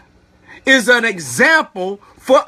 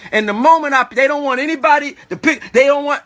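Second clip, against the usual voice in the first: ordinary delivery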